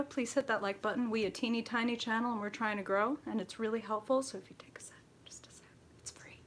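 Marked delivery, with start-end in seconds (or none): 4.2-6.4 hushed aside; whisper. Real, not acted. Asking viewers to like the video, whispered aside. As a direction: drop to a secretive whisper. Sheepish plea to hit like.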